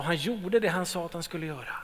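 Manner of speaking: normal talking voice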